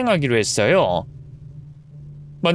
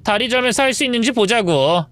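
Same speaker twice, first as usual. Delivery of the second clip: taunting mistress; 8 semitones higher than usual. Acted. Mistress dodges the limping wife, taunting. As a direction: tease her while dodging.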